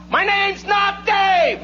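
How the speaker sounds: high, long-drawn holler; exasperated yell. Acted. Fed-up fan yells up into the bleachers, exasperated holler. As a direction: stretch every word in a shout to the far-off stands. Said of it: "looks up into the bleachers and yells".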